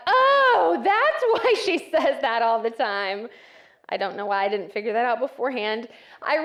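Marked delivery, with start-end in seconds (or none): 0.1-3.3 sudden realization. Retold or imitated. Realizing what inshallah means.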